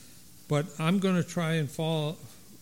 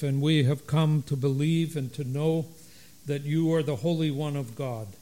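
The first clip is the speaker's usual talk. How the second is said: liturgical prayer. Acted. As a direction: lead the flock in solemn prayer. Leads liturgical call and prayer.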